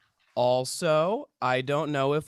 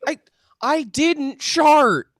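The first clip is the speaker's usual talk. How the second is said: high, loud and slow, insisting; indignant denial; 7 semitones higher, 8 dB louder, slower than usual. Acted. To laughing friends, loud indignant slow denial. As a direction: plant your feet and deny it loudly.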